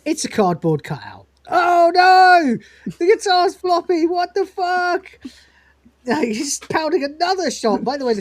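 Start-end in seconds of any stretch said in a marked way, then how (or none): 1.5-5.0 high, loud mock wail; dismayed outcry. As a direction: cry out in hammed-up horror. Lister discovers fake guitar, acted.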